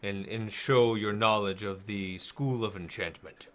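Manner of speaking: deep, deliberate voice of a king's adviser; Parthon. Acted. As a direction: speak low and measured, pausing between phrases. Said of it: "it's the voice of Parthon".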